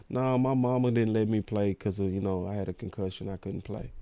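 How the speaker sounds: a soft, sheepish pundit excuse; mock whiny excuse. Host mocks a pundit who never played, sheepish. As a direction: mumble it like a nervous guy caught out.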